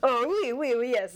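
oui oui in a hammed-up French lilt; mock French accent. Acted French soldier answering the commander, mock French accent. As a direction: put on a cartoon French accent. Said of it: "what's a French accent".

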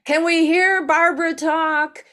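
calling out from the back to ask for Barbara; woman calling out. Retold or imitated. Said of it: "I hear this woman go".

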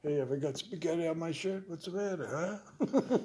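comic stage bit. Retold or imitated. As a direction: play up the goofy stage gag.

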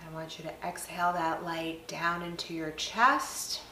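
soft, unhurried meditation voice with long pauses; guided meditation. Acted. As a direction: guide them gently through each breath, leaving space between phrases. Leads a guided breathing meditation.

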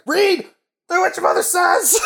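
high mock shout from another room; imitated dad calling. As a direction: call out to the kid, strained and high. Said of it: "I'm in the other room".

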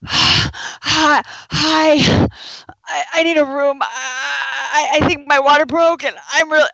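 high, loud, panicky voice; high loud mock panic at the desk; frantic patient. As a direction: play a woman in real labor, breathless and urgent. Mocking patient in real labor.

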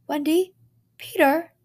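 high, loud call. A narrator's reading. Parents search calling for their kids, loud. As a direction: call through the house.

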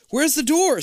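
high, loud frantic yell. Acted. Stacy yelling for the exit in a high loud voice. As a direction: yell it out searching. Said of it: "Stacy yells".

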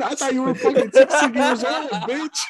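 high, laughing tease. Real, not acted. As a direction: laugh through the jab. Teasing friend while laughing.

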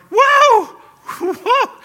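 very high, loud joyful whoop; excited scream. Retold or imitated. Fisherman whooping over a big bass, very loud and high. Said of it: "I was screaming".

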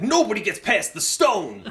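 a hint of a self-important guard, laughing; lightly voiced guard boasting; mock tough guard. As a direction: puff up like a mall cop hero. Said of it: "He's like".

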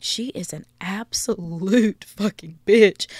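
slow, mock judging voice put on for the crowd; a hint of a sneering listener, drawn out; imagined audience voice. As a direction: play the crowd judging her, slow and mocking. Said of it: "Y'all are probably thinking".

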